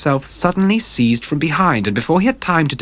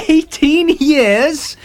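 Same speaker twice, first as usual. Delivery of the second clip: very high, slow laughing "Eighteen years?"; triumphant laugh; 4 dB louder, much slower than usual. A narrator's reading. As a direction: savour your escape, laughing. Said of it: "with a touch of triumph in his voice".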